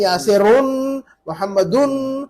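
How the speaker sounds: यासिरुन मोहम्मदुन slowly, each ending in a long "-unn"; tanween stressed. Shown as a demonstration. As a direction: hold the closing n of यासिरुन and मोहम्मदुन to show the tanween. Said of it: "तनवीन पढ़ रहे हैं कि नहीं".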